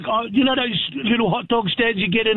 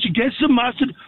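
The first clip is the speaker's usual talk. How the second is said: usual speaking voice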